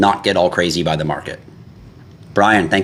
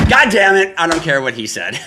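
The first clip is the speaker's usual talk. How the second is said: exasperated outburst; 9 semitones higher than usual. Retold or imitated. Exasperated retort to follower.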